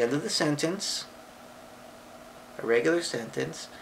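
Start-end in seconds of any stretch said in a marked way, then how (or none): none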